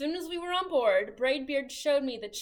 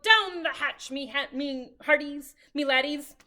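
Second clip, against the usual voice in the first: loud table-pounding toast; pirate yell; 7 dB louder than usual. A narrator's reading. Captain yells a toast at the dinner table. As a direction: bellow a rowdy toast. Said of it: "pounded his fist on the table and yelled".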